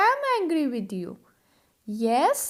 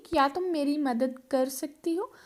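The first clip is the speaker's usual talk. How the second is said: ordinary delivery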